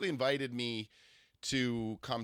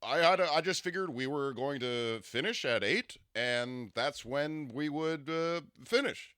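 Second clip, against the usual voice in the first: slow, higher put-on voice with deliberate cadence; Barack Obama; 4 semitones higher, slower than usual. Imitating her date's reason for leaving, slow formal impression. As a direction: mimic his measured announcer-like speech. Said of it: "I'm gonna imitate his voice".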